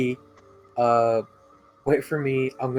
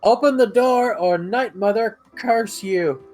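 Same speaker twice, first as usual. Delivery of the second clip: threatening shout; 8 semitones higher, 4 dB louder, slower than usual. Pretend. Player threatens with a curse.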